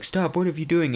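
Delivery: high-pitched retold plea; mock alarmed protest. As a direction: act out alarm without shouting. Repeats the man's alarmed protest.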